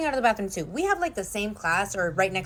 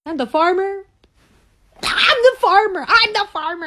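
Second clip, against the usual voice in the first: loud, high outraged accusation; accusing yell; 5 semitones higher, 8 dB louder than usual. Real, not acted. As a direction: blow up at him for cheating. Girlfriend reveals cheating test, yelling.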